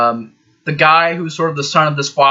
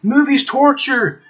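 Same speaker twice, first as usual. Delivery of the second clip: much higher, pleading mock voice; alarm clock begging; 11 semitones higher, slower than usual. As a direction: whine at him to make it stop. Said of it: "It's like".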